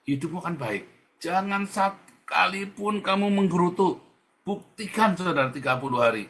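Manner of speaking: loud, high fervent preaching; preaching at full force. Acted. Fiery sermon climax. (khotbah berapi-api, suara keras dan tinggi)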